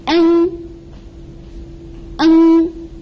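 N as one held, level hum from throat and nose; throat-and-nose sound. Shown as a demonstration. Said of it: "the sound comes from, uh, your throat".

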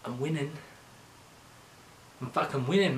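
ordinary delivery

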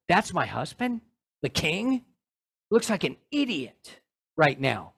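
wife sneering softly at her dancing husband, contemptuous; contemptuous muttering. Pretend. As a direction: mutter it with quiet disdain. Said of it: "with contempt for him".

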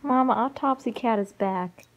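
loud call to another room. Real, not acted. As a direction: announce it loudly to mom. Calling out to mom.

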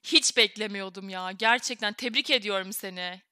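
usual speaking voice

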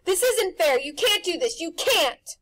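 put-on boy shouts at the house; angry protest. A narrator's reading. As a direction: rage at the injustice.

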